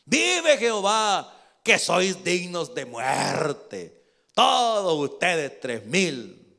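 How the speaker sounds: loud, slow, drawn-out condemnation; shouted rebuke. Acted. David condemning the king's guards, shouted rebuke. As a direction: proclaim it like an oath.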